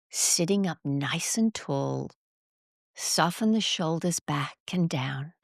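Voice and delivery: calm, measured breathing guide with long pauses; guided meditation. Real, not acted. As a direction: guide them through each breath, counting evenly.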